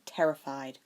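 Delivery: usual speaking voice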